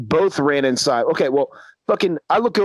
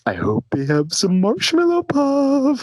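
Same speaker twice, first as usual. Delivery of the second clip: dreamy stoned friend; 8 semitones higher than usual. Retold or imitated.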